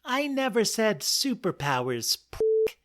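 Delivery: high squeaky mouse, last word hissed; annoyed tiny squeak. Acted. Mouse correcting the lion, high squeak. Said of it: "said the mouse".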